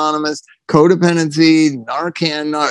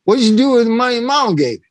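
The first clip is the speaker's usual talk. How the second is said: fast, higher mock clerk voice; store clerk; 6 semitones higher, much faster than usual. Clerk asks the singer about his mama's money, fast and higher. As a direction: rattle it off like the puzzled clerk.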